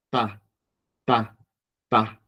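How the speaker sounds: "Ta, ta, ta" with a tapped, crisp T; struck T. Shown as a demonstration. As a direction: strike the T in each separate "Ta, ta, ta". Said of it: "La vamos a golpear como una T".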